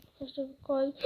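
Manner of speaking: ordinary talk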